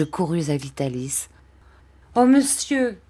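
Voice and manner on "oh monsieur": put-on high, urgent child's cry; pleading cry. A narrator's reading. Orphan boy appeals to the stranger, crying out. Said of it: "m'écriai-je".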